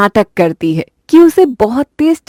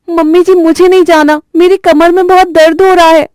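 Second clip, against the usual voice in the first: high, whining excuse; whining bride; 7 semitones higher than usual. Acted. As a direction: bride refusing to meet the guests. (ऊँची आवाज़ में बहाना बनाती बहू)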